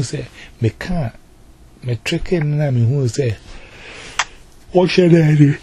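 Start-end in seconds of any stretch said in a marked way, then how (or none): 4.7-5.5 louder, higher cry of helplessness; raised-voice retold exclamation; exclaimed retold plea. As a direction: throw it out loudly, at a loss. Retells own moment of despair.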